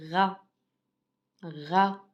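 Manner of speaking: "Ra" as one isolated syllable with a French R; pronunciation demo. Shown as a demonstration. Teacher shows pupils R with A, "Ra" said separate and distinct. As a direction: model "Ra" distinctly, pause, repeat it. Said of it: "comment je prononce?".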